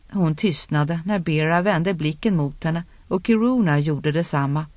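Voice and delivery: ordinary delivery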